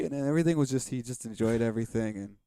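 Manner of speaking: ordinary talk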